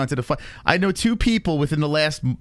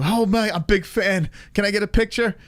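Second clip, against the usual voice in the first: higher-pitched put-on fan voice; pitched-up mock starstruck fan; eager fan; 7 semitones higher than usual. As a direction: imitate a gushing fan asking for a photo.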